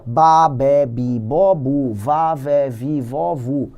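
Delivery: each syllable spoken separately, in order; sounding out syllables. Shown as a demonstration. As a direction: drill "Ba, be, bi, bo, bu" one syllable at a time like a phonics class. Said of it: "Você aprende sílaba por sílaba".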